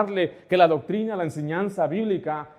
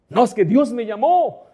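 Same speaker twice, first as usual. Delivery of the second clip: higher, louder retort, imitating a friend; indignant protest; 6 semitones higher, 5 dB louder than usual. Friend insists to the preacher on his calling, indignant protest. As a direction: push back insistently, as a retold objection. Retold friend objects to correction.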